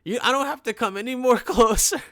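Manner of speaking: mock insistent voice. Friend insisting he stays away.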